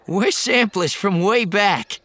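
put-on high, loud nervous young man. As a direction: squeak it out like a startled kid. Post voiced.

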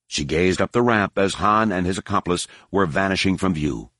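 usual speaking voice

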